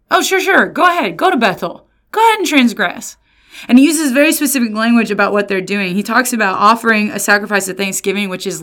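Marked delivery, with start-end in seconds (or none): 0.1-3.2 high mock-cheerful sarcasm; sarcastic. God mocking Israel, high sarcastic fake encouragement. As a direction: egg them on in fake approval. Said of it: "what God is doing here".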